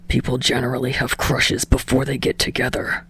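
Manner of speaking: low, soft grinding irritation; understated teeth-clenched grumble; through clenched teeth. A narrator's reading. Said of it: "grinds out from behind clenched teeth".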